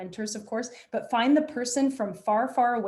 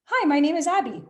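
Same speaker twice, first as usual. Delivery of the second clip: partner's reply; 7 semitones higher, 6 dB louder than usual. Shown as a demonstration. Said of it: "give to my next partner".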